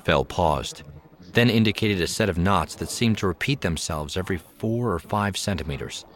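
ordinary delivery

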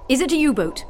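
a touch of urgency; urgent. A narrator's reading. Captain asking operator about the ping, urgent. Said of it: "asked Fitzgerald urgently".

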